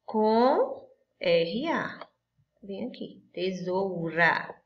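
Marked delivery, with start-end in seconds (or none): 0.0-2.1 letters drawn out very slowly; slow spelling out. Shown as a demonstration. Teacher spelling the ending for the class, very slow. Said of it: "Ela termina com o quê?". 3.3-4.5 tesoura stretched slowly, each syllable clear; slow, stretched word. Shown as a demonstration. Teacher modelling the word for children, slowly stretched. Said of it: "isso quer dizer que uma palavra que rima".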